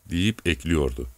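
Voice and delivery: plain reading voice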